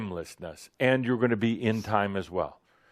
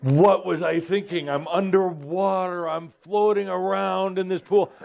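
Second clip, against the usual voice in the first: much higher and louder, panicked; gasping for air in alarm; hammed-up drowning panic; mock panic; 11 semitones higher, 6 dB louder than usual.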